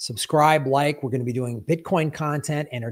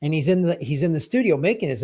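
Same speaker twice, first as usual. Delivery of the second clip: ordinary delivery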